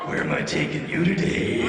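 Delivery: slow, very deep trailer voice with long rumbling pauses; creepy deep-voiced cabbie. Cab driver greeting a passenger, creepy deep announcer voice. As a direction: rumble it slowly, with a creepy low chuckle. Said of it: "You get in the taxi with him, he's like".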